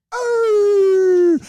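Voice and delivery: high loud hacking cry as the fish flies out; dog coughing a mackerel onto the sand, loud high cry. Retold or imitated. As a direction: hack it out in one big cry.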